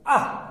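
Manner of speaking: "आह" as long aa ending in a light h; visarga pronunciation. Shown as a demonstration. Teacher showing visarga after long aa, pronounced demonstration. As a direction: say "आह" with aa released into a half h. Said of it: "है के बाद ए नहीं बोलना".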